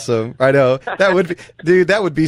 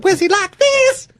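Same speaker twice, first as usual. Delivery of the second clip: squeaky high falsetto; slower than usual. Retold or imitated. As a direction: jump way up high and slow. Imitating [name] falsetto.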